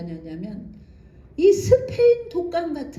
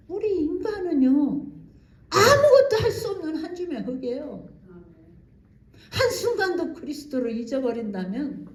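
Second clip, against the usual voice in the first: ordinary delivery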